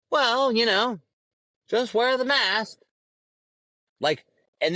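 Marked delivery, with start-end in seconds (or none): 0.1-2.8 higher, drawn-out mock advice; mock smug advice. Ranter mocks mask-wearers in a lifted, mocking voice.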